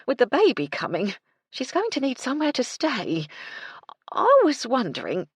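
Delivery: breathless, eager housekeeper, quickening at the end; a bustling cook hurrying up to her employer; Mrs Chubb voice. Acted. Mrs Chubb pleading for Gertie.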